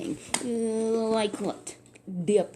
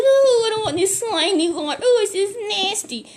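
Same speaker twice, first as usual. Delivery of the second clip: high, loud put-on cry of disgust; disgusted whine; 12 semitones higher, 7 dB louder than usual. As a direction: recoil from the slimy water. Naaman disgusted by slimy water.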